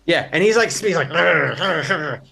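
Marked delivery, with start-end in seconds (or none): 1.1-2.2 imitated monkey snarls; snapping growls. Game master imitating the tied-up monkey, snapping snarls. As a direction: growl like an animal struggling to get loose. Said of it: "he's, like, snapping".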